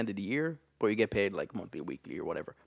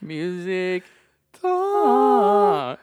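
announcer intro; 5 semitones higher, 9 dB louder, much slower than usual. Acted. Third jingle attempt, laughing.

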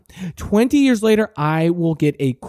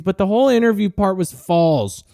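normal talking voice

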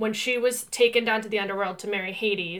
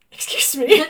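rising, louder offended question; indignant; 4 semitones higher, 9 dB louder than usual. Retold or imitated. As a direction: act shocked at the ad. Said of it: "And I was like".

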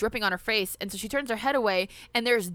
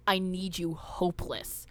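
lightly voiced slow, low threat; soft, drawn-out menace, understated; menacing demon girl; 4 semitones lower, 5 dB quieter, slower than usual. Quoting the film's demon girl.

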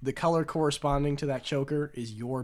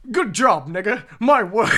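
loud, higher, laughing exclamation; posh man's delighted shout; 7 semitones higher, 12 dB louder than usual. Retold or imitated. Posh diner loudly praising the cook, laughing shout. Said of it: "He's like".

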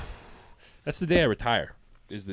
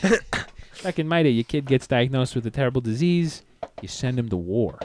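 loud gruff boomer; old-timer tough guy; 4 semitones higher, 5 dB louder than usual. Pretend. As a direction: bark it like a crusty old-timer.

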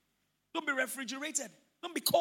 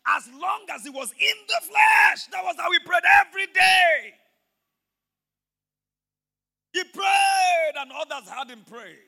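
loud, higher, slow preacher's cry; preaching at full force; 6 semitones higher, 11 dB louder, slower than usual. Acted. Pastor preaching to the congregation that God answers prayers, full force. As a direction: lift the church with each line.

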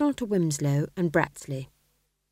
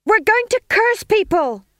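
very high, loud chant; shouted chorus; 9 dB louder than usual. A narrator's reading. Witches springing to attention, chorusing loudly. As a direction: yell it out in unison. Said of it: "they chorused".

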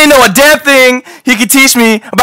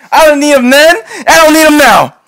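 crying; 4 semitones higher, 4 dB louder than usual. Acted. Will breaks down about father.

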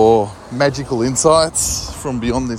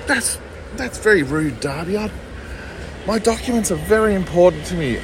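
lightly higher, a touch of hurt pride; a mum mildly miffed at her son; mildly offended mum; 7 semitones higher than usual. Retold or imitated. Mum scolds her son for being rude, mildly hurt. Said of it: "I just channeled the spirit of my mum".

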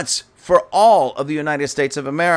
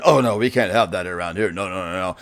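lower-pitched, dismissive parent refusal; mock disapproving parent; 8 semitones lower, faster than usual. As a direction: shut the child down in a mock low voice. Voices disapproving parent refusing child.

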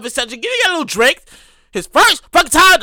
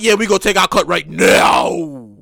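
union dudes; 9 semitones lower, slower than usual. Pretend. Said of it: "the union dudes was like".

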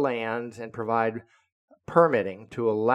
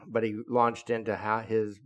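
ordinary talk

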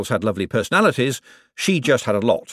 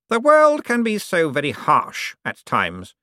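condescending lord to servant; Cosmo's voice; 8 semitones higher, slower than usual. Acted. To his butler, Cosmo's lofty patronizing voice.

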